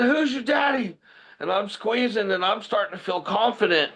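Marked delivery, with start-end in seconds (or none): 0.0-1.0 high-pitched retold shout; shouted taunt. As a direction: crow it over a pinned opponent. Retelling his taunt to pinned bully.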